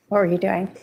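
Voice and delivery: ordinary talk, nothing marked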